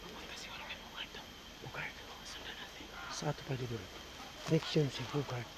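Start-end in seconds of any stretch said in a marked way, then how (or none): 0.0-2.9 hushed whisper while spying. Real, not acted. Watching Dango secretly, whispering to the camera. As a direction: whisper so Dango won't hear.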